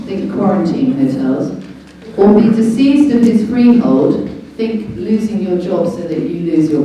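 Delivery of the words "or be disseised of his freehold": reading the charter out louder, each word weighted; emphatic reading. Shown as a demonstration. Reading Magna Carta aloud to viewers, emphatic and louder. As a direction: proclaim it like a law read aloud. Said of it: "I shall read it out to you".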